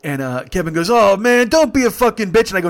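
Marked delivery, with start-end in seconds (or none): 0.9-2.5 loud, high exasperated complaint; mock screaming. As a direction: shout at your drunk buddy, acted out. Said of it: "No screaming".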